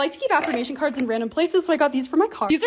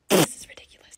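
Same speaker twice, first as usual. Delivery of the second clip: whisper. Real, not acted. Whispering on sick voice.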